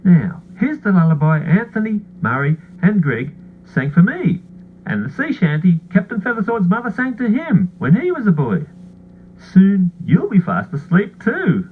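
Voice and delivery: ordinary delivery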